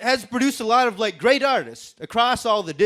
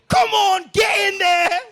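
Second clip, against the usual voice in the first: high, loud mock Queen egging someone on; shouting Queen; 13 semitones higher, 4 dB louder than usual. Comic acting the Queen urging her people on, shrill mock shouting. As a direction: belt it out as a hammed-up, high-pitched Queen. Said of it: "'cause the Queen's like".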